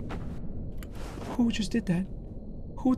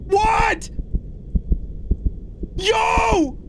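high, wailing pleas; high-pitched yelps of panic; panicked pleading; 11 semitones higher, much slower than usual. Real, not acted. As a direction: beg in a high, frightened cry. Pleading in fright at game.

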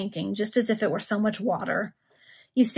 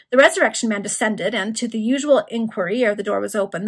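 ordinary delivery

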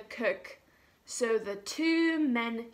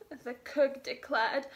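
plain reading voice

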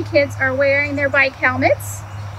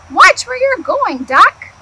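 high, louder irritated snap; annoyed chicken; 10 semitones higher, 9 dB louder than usual. Acted. Chicken thinking crossly at Duck, high and loud. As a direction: scold him like a flustered hen.

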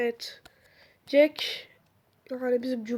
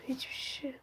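whisper; 15 dB quieter than usual. Real, not acted. Whispered while hiding.

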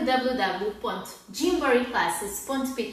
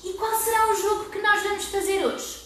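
put-on higher, sing-song question to children; kindergarten teacher voice; 7 semitones higher than usual. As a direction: ask it like you're talking to toddlers. Engaging children, high pitch. (voz de educadora de infância)

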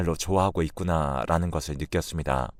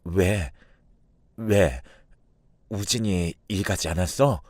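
nervous, halting question; mildly shaken, uneasy asking; trembling voice; slower than usual. A narrator's reading. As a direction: hesitate anxiously between each word. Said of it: "덜덜 떨리는 목소리로".

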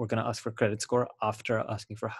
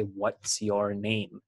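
slow natural question; much slower than usual. Shown as a demonstration. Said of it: "So you're gonna say".

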